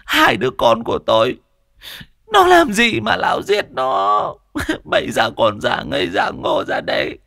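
put-on woman cursing the old man who killed her children; shrill grieving woman. A narrator's reading. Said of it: "gân cổ lên".

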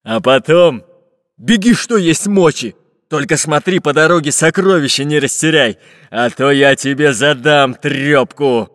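loud gruff pirate threat; pirate threatening. Acted. Pirate Bul-Bul threatens the spy, loud gruff voice. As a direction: threaten him like a rough captain. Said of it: "пригрозил пират Буль-Буль".

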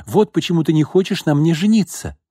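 jealous fiancée accusing him of an affair, angry; jealous accusation. A narrator's reading. Jealous woman accusing her fiancé.

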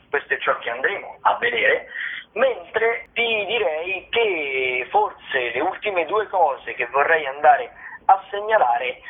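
ordinary delivery

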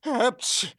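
high sudden stage sneeze; acted sneeze. A narrator's reading. Robber sneezes before querying the idea, acted sneeze. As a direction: cut in with a sneeze. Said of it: "чихнув".

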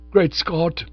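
plain reading voice

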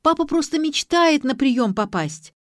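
high, loud pleading protest; child's acted insistence; shouting protest. A narrator's reading. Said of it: "закричала Ксю".

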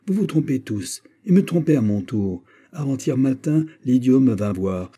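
ordinary delivery, nothing marked

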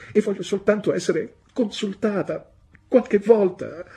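high, rising, tearful plea; pleading young wife. A narrator's reading. Young wife pleading with her stern husband, high and tearful. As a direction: beg to be heard, voice climbing.

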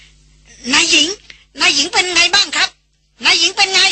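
high, urgent calling out to the mistress; panicked porters crowding round; alarmed asking. A narrator's reading. Porters crowding round the fainted mistress, alarmed. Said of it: "ถามกันให้แซ่ดด้วยความตกใจ".

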